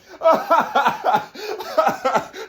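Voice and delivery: shrill derisive laugh burst; high mocking cackle. Onlooker cackling at the poor man. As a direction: cackle at him.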